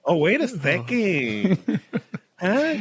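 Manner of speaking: high, slow mock-sassy accent; high, hammed-up sassy Latin voice. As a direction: draw it out with put-on sass. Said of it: "get sassy with it".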